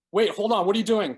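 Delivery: high, loud panicked plea; frightened pleading. A narrator's reading. Tyler begging Gordon as he reaches for the knife, panicked plea. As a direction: beg him to stop, loud and scared. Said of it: "Tyler pleaded".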